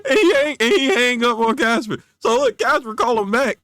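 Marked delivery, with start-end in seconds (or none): 0.0-2.0 high, loud, laughing storyteller; cracking up. Real, not acted. Teller cracking up at his story's hang-up, laughing. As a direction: barely get it out through real laughter.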